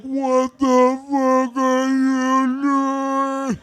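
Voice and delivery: very slow, drawn-out disbelief; slow, stunned, half-laughing disbelief; appalled future self. Retold or imitated. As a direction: stretch every word in horror at your younger self. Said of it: "Future me is like".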